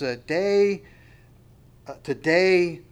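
stretched, punched "day ... to day"; emphasizing the phrase. Shown as a demonstration. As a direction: lean hard on "day" and again "day". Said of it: "I just wanna emphasize".

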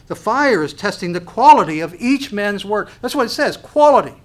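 fiery sermon emphasis; emphatic preaching. Real, not acted. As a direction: hammer the word quality home. Preacher hammering the word quality.

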